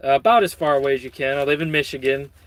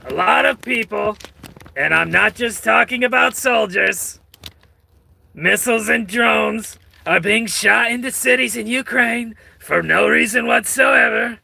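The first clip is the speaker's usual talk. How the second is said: a hint of Trump; 5 semitones higher than usual. Vlogger reading Trump's post in a light Trump voice. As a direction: lightly slip into Trump for the quote.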